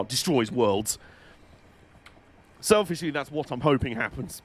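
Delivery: usual speaking voice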